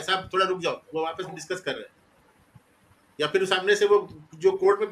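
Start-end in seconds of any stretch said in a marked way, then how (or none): 0.0-1.9 mild hushed tip-off. Retold or imitated. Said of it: "बताता है".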